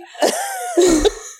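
bursting into high laughter; laughing at her own cheek; high giddy laugh. Real, not acted. Laughing with the listener after reading out her text.

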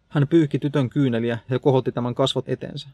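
plain reading voice

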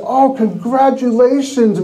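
high, slow, put-on delight; fake congratulations. Envious friend congratulating the winner, falsely delighted. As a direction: congratulate with forced cheer. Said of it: "Meanwhile, going".

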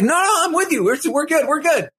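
very high-pitched hasty placating; mock panicky reassurance. [name] reassures Paloma he is with her, flustered. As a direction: imitate someone scrambling to smooth it over.

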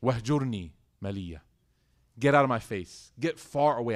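loud, cold order to get out; angry dismissal. Retold or imitated. Father banishing his son, loud and angry. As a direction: bark him out of your sight.

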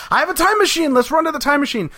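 high, urgent cry to run; excited. Retold or imitated. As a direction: shout it out in a hurry. Doctor urgently calls to run.

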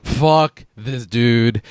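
very slow, drawn-out exasperation; slow, deliberate fed-up verdict; retold disgust. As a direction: stretch out each word in mock disgust. Retelling his disgust at the movie.

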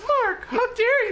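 very high, drawn-out scolding; mock outraged mother. Playing his mom upset by the episode, high scolding. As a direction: scold in a squeaky put-on mom voice.

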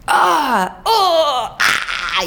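high mock dying cries, breaking into a laugh; mock dying noises. Recalling voice-acting dying noises, high mock cries. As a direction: play the dying noises from the recording booth. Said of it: "you go like".

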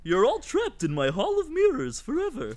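creepy clown voice. Pretend. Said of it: "the actual funny clown voice".

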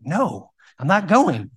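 loud, slow stubborn no; defiant refusal. Retold or imitated. Defying the security guard, loud and slow. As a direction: refuse him, dragging every word out.

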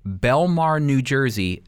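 normal talking voice

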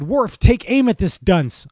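first officer telling Worf to fire; Riker's order. Acted. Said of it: "And Riker said".

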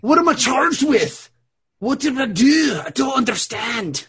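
high-pitched, bad Scottish accent; hammed-up Scottish protest, pitched well up; Connor MacLeod. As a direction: wail it in a mock Scottish accent. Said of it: "I sound like fucking Connor McLeod".

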